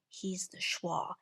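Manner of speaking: hushed, drawn-out secret; slow whisper. Shown as a demonstration. Teacher telling kids the schwa secret, slow whisper. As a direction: whisper it slowly and soft.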